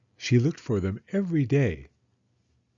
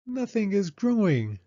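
put-on high-pitched child voice; a small girl disappointed, voice up high; Penny to Mama in the brown garden, high and let down; little girl; 10 semitones higher than usual. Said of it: "said Penny".

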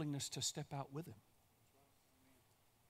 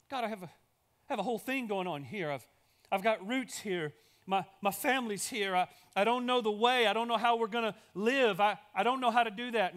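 higher and louder, flustered pleading; anxious protest; 5 semitones higher, 7 dB louder than usual. Acted. Abraham pleading with God not to uproot his family, panicked. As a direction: push back at God in a panic, stumbling.

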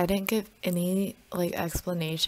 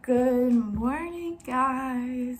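groggy morning greeting; 5 semitones higher, much slower than usual. Real, not acted. Sleepy morning vlog greeting.

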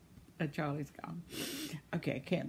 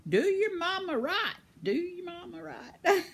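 higher, singsong playful warning, breaking into a laugh; playful scolding; 10 semitones higher than usual. Pretend. A message for a viewer's son, playful mock-stern order. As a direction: wag a finger with a grin. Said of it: "And say".

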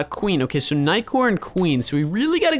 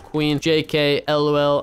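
normal talking voice

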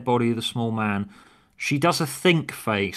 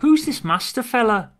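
high companion voice; 13 semitones higher, 5 dB louder than usual. Acted. Acted script dialogue, companion voice.

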